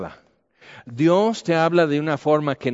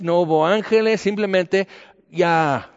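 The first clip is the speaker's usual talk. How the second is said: ordinary delivery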